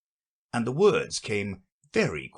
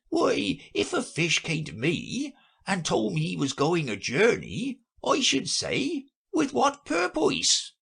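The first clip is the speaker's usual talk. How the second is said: put-on a pompous old turtle lecturing; Mock Turtle's voice; 12 semitones higher than usual. A narrator's reading. Mock Turtle explains porpoises to Alice, high fussy voice.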